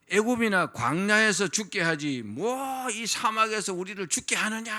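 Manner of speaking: slow, lightly voiced grumbling; a hint of whining complainers; complaining Israelites. Preacher acting out the Israelites grumbling in the desert, mild mock whine. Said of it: "이렇게 말했어요".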